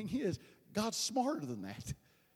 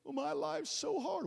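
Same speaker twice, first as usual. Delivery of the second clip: high, slow pity-me whine; hammed-up self-pity; mock whining; 6 semitones higher, slower than usual. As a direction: put on a pitiful complaint. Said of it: "What are you whining about?".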